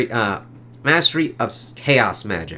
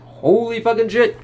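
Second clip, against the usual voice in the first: amazed exclamation; 11 semitones higher than usual. Retold or imitated. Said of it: "It's like".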